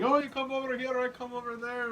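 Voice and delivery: mock German-accented English, pitched high; Martin. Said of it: "No, he's just like".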